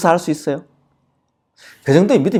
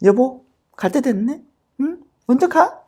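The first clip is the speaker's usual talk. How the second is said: 무심한 작별; 10 semitones higher, 4 dB quieter than usual. Acted. 아내의 가벼운 작별 흉내.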